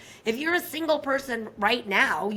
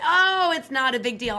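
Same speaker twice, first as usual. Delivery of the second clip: louder, exaggerated brush-off; dismissive minimizing; 3 semitones higher, 6 dB louder than usual. Business partner downplaying a problem at work, hammed-up dismissive. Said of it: "and he'd say".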